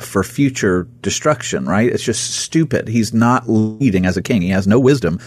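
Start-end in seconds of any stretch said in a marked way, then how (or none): none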